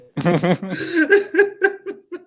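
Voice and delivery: shrill burst of laughter; squealing laugh at the joke; high laugh. Real, not acted. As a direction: crack up high and loud. Laughs at the fanfic line.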